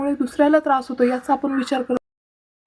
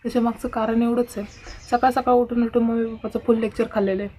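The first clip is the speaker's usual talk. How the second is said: usual speaking voice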